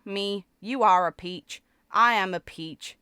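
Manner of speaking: ordinary talk, nothing marked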